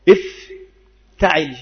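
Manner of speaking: افتعل said very slowly, each sound drawn apart; pattern spelled out slowly. Shown as a demonstration. Teacher slowly spelling out the pattern افتعل for students. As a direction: stretch افتعل out syllable by syllable so students hear the pattern. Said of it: "فهذه الصيغة".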